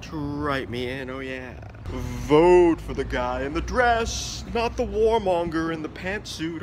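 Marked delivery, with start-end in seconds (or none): 1.8-6.6 stretched, very slow campaign slogan. Pretend. Candidate spells out his slogan very slowly. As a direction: draw each word out like a billboard.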